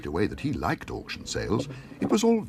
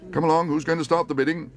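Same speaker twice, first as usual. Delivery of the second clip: high, louder coaxing call; auctioneer; 9 semitones higher, 4 dB louder than usual. Acted. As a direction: urge the room to bid. Auctioneer urging bidders.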